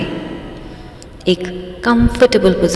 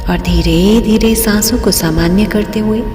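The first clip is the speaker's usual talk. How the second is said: guided meditation. Real, not acted. Guide leading listeners into breathing and relaxation, meditative. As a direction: lead listeners unhurriedly through each breath.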